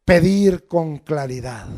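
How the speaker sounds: loud, emphatic preacher's voice; preacher exhorting on prayer; fervent preaching. Acted. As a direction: hammer each point home. Preaching at full force.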